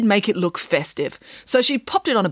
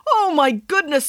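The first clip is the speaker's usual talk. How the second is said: higher, delighted gasp; delighted surprise, pitched up; surprised; 9 semitones higher than usual. A narrator's reading. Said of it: "said her husband".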